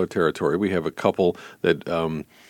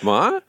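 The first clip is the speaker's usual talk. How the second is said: "Ma?" pitched up as a question; questioning sitcom call; 5 semitones higher, 3 dB louder than usual. Retold or imitated. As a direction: mimic a mispronounced title, "Ma?" rising high. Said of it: "It sounded like".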